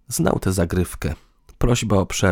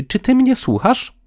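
high, louder demanding question; indignant check; 5 dB louder than usual. A narrator's reading. Woman asks distracted partner for attention, indignantly.

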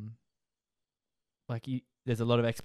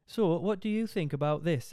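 higher mock Northern English teacher; lightly voiced teacher in Northern English accent; 6 semitones higher, 4 dB louder than usual. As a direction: play the English teacher asking. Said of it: "the teacher looked at me".

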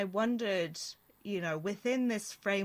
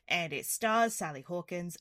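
usual speaking voice

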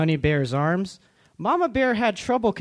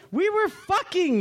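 high yelled outburst; 12 semitones higher than usual. A narrator's reading. Papa Bear yelling at his grown kids. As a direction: yell it out in a high voice. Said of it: "he yelled".

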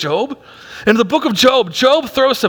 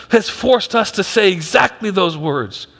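normal talking voice

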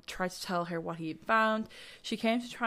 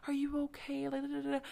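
high, soft, put-on concerned voice; a creepy imitation of a doting dad; mock creepy father; 8 semitones higher, 5 dB quieter than usual. Mocking the suspect fussing over his kids, high and soft. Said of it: "he's like".

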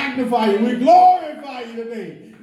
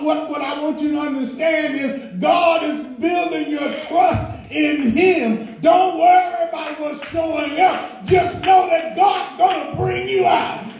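building to high, shouted exhortation; fervent preaching; 5 semitones higher than usual. Real, not acted. Urging the congregation to trust God, rising preaching shout. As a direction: drive it upward into a praise shout.

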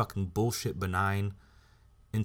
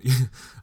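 short laugh; 4 semitones higher, 10 dB louder than usual. Real, not acted. Laughs at own line.

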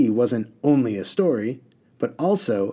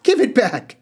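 high, loud irritated order; snappish demand; 13 semitones higher, 7 dB louder than usual. A narrator's reading. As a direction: demand it back sharply, pitched way up. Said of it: "he snapped".

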